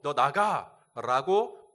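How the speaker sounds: a slow, loud rebuke to a demon; stern command. Retold or imitated. Preacher rebuking a demon, loud and slow. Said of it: "꾸짖었습니다".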